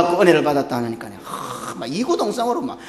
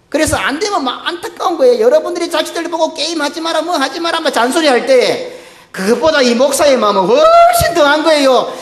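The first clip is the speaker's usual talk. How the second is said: high, loud preaching at full force, half laughing; impassioned preaching; 9 semitones higher, 4 dB louder than usual. Real, not acted. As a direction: pour out a pastor's heart, loud and high. 설교 절정, 힘주어 외침. (높고 크게 열정적으로 외치는 설교)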